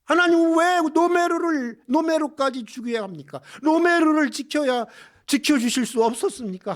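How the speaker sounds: high, emphatic pleading challenge; impassioned retold outcry; acted demanding protest. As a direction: cry out in protest as the nuns, demanding an answer. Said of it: "막 따져 묻는 거예요".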